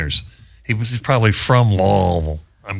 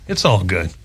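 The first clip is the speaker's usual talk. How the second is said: normal talking voice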